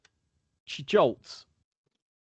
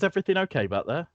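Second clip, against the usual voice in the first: higher, quick concerned driver; Marion's voice; 5 semitones higher than usual. Acted. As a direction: call back over the shoulder, higher. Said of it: "and she says".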